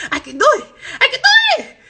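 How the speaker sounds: high squeal, the second one shooting up loud; excited triumph. Retold or imitated. Kid finally rolls the R, excited triumph. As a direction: squeal it like a thrilled kid who just got it.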